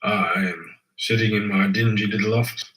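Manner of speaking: ordinary delivery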